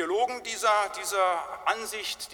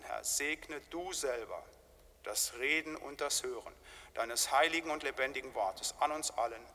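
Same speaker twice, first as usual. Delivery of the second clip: hushed, reverent prayer voice, much softer; 5 semitones lower, 9 dB quieter than usual. Acted. Pastor praying over the sermon, soft and reverent. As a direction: pray it quietly before the congregation.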